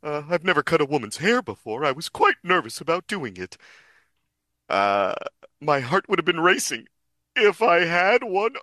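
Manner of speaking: high, theatrical falsetto character; Brook. Acted. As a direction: play it in a high, hooting cartoon voice. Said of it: "I can't not do Ian".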